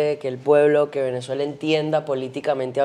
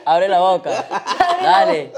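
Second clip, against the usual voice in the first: put-on feeding a baby; 6 semitones higher than usual. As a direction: laugh while urging a baby to eat. Urging blindfolded guest to eat.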